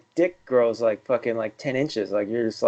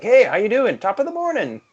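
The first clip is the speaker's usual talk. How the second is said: high, sing-song mock friendly hello; cheery greeter; 9 semitones higher than usual. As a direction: play an overly chipper passer-by.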